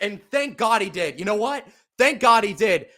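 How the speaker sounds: relieved cry. Real, not acted. Relief at the sack.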